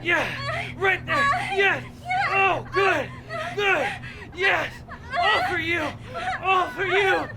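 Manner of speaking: very high, breathy pleased cries; high-pitched moaning. Acted. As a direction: squeal with delight, high up. High pleased cries during act.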